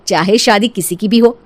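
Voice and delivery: ordinary delivery, nothing marked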